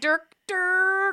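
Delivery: high cheerful Dirk, Dirk; excited call. Acted. Joining in greeting the penguin, high excited call. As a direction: repeat the name with glee.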